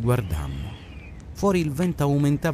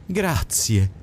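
dry, mocking thanks; lightly sarcastic thank-you; a bit sarcastic; 5 semitones higher than usual. A narrator's reading. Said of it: "fece lui un po' sarcastico".

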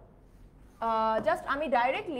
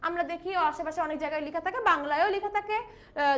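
ordinary talk

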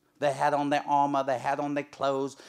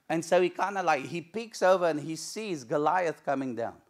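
usual speaking voice

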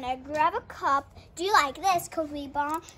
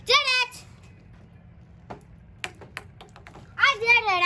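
excited cheer; 7 semitones higher, 4 dB quieter than usual. Real, not acted. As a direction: cheer the win. Victory in challenge.

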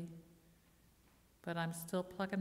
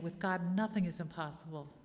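louder, with conviction; said like you mean it; 4 dB louder than usual. Shown as a demonstration. Preacher urging the congregation, said with full conviction. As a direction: declare it like you truly believe it. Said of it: "Say it like you mean it".

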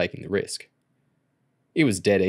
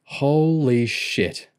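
slow, drawn-out stunned gasp; shocked gasp; 5 semitones higher, much slower than usual. A narrator's reading. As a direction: breathe it out in shock. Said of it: "Junior gasped".

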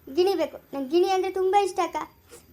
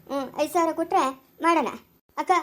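ordinary delivery

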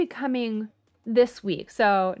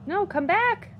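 much higher and louder frantic call; high yell after fleeing dragon; 10 semitones higher, 4 dB louder than usual. Real, not acted. Player yells at the dragon flying off, high and loud. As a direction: shout after it, desperate for its soul.

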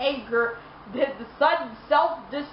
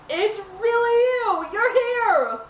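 excited; 8 semitones higher, slower than usual. Acted. Joker greeting Batman excitedly.

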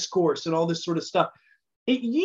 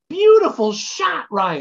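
imitating a sweet, upbeat mom, higher, louder and slow; Barb Painter; 8 semitones higher, 6 dB louder, slower than usual. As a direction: gush over it like a doting mother. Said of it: "and she said".

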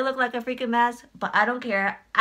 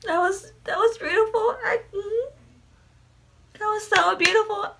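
teary awe; 10 semitones higher than usual. Real, not acted. Moved to tears by performance.

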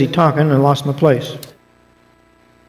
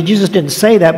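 ordinary delivery

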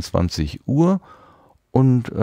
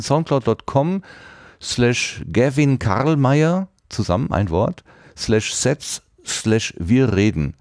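slow, careful dictation; spelling out slowly; 3 semitones higher, slower than usual. Shown as a demonstration. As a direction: read the URL out slowly. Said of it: "sollte die U R L mal eben sagen".

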